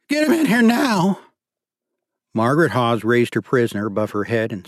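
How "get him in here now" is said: high, loud, desperate yell; furious shout. A narrator's reading. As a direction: scream it at the police. Hostage-taker demands from house.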